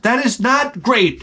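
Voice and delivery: ordinary talk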